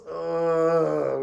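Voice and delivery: long drawn-out groan; class groan. A narrator's reading. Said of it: "we all groaned".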